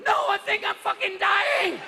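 high, slow, agonized shout; mock dying wail. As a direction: wail as if your heart is giving out. Answers mid-sex with heart failing.

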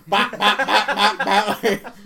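loud staccato bops; rapid-fire mock blows. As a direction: fire off cartoon hit sounds with a laugh. Acts out knocking down defense attorneys.

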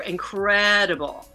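ordinary delivery

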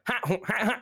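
high, clipped grunts; game jump sound. Retold or imitated. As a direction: puff out a grunt on each jump. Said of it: "Falco and Fox were just like".

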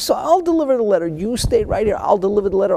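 put-on old market woman begging the girl to stay, high and pleading. Older woman offers to go instead.

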